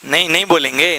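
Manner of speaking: ordinary talk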